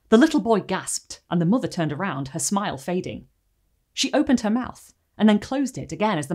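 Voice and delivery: measured storyteller reading a creepy tale; story narration. A narrator's reading. Reads the story aloud.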